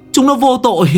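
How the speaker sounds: calling out. Acted. Young master shouting that the ghost children are innocent. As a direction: cry out that they are innocent. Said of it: "Cậu kêu lên".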